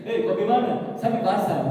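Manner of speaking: high, loud clipped Papuan speech; clipped Papuan-accented question. Retold or imitated. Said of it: "Kayak orang Papua".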